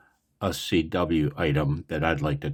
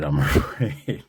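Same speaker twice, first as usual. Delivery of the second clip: normal talking voice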